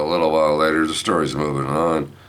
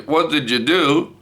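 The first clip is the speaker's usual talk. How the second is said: high-pitched accusing call; mock yelling; 10 semitones higher than usual. Wife yelling down the hallway, high mock yell. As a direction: imitate a holler down the hall. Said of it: "Kathy's yelling down the hallway".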